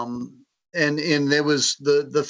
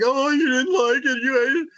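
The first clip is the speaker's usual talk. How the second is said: worried co-author; 10 semitones higher than usual. Retold or imitated. Co-author voiced, fearing rejection.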